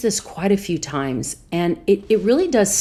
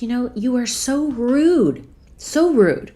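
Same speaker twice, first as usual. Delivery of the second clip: slow, higher-pitched rebuke; retold indignation; 5 semitones higher, slower than usual. Telling off rude pharmacy staff, indignant. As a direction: draw out the indignant scolding. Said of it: "I was angry. I was upset".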